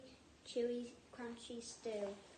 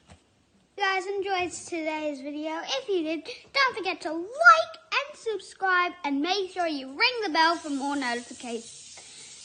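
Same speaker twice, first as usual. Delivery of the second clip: loud upbeat sign-off; loud outro; 5 semitones higher, 16 dB louder than usual. Real, not acted. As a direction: hype up the viewers.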